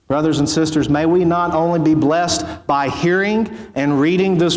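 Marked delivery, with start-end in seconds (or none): none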